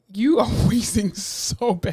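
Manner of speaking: slow, laughing tease. Real, not acted. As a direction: laugh through the words. Laughing at friend's wheeze.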